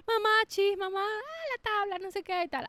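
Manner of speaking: high mock child whine; whining child. Child complains to mother at bedtime, mock whine. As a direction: imitate a kid pestering mum at bedtime.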